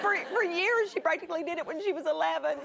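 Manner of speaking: usual speaking voice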